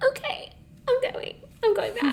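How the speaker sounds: high laughing squeal of joy; giddy squeal. Retold or imitated. Retelling her delight at the nail money, squealing. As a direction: squeal it like you can't believe your luck. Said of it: "And I was like".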